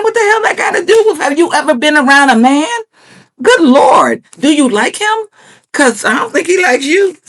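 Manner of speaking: high-pitched incredulous scolding; exasperated disbelief. Retold or imitated. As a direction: fire questions at her, exasperated. Mock rebuke of show contestant.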